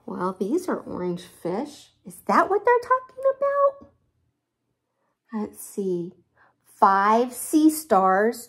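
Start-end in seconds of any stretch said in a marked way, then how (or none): 2.0-3.9 surprised. Real, not acted. Puzzling over the fish. 6.6-8.5 slow. Real, not acted. Slowly reading the list.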